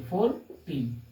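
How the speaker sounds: Fourteen slowly split as फोर, pause, टीन; separated syllables. Shown as a demonstration. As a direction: say fourteen in two drawn-out beats. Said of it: "इस तरह से आपको separate करके". (Fourteen को फोर और टीन में अलग करके धीरे बोलें)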